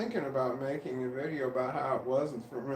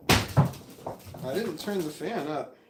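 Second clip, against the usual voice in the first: normal talking voice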